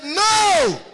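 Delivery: high, loud shout of no; the boy yells his refusal. Acted.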